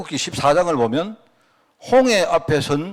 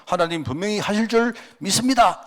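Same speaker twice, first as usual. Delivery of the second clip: higher, pressing voice of a pushy believer; mock pushy believer; 7 semitones higher than usual. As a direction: lay the pressure on God with a forced smile. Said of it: "강제성으로 느끼지 않을까".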